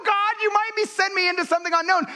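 high, pleading mock prayer to God; mock worried prayer. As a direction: voice the fearful believer, hammed-up and high. Imagined prayer of a hesitant believer.

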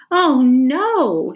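a panicked shout of oh no; startled cry of dismay. A narrator's reading. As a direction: shout it in alarm. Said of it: "shouted Stephen".

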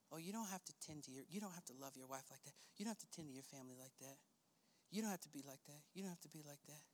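quick, very soft low murmur; hushed, acted tempter's patter; tempting inner voice. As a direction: insinuate it under the breath.

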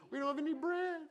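high, put-on whine of a complaining crowd; whiny complaining Israelites. Preacher mimics Israelites complaining to Moses, high whiny voice. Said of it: "they're just murmuring".

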